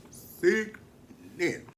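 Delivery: slow, stretched sickening; drawn out. Real, not acted. Praising the dress, slow and stretched. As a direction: savor the word. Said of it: "drawn out".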